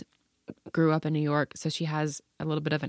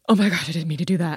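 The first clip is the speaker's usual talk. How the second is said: fast, loud, horrified apology; dismayed; 6 dB louder, faster than usual. Retold or imitated. Daughter mortified at mocking Liz, fast loud blurt. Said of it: "she's like, gasps".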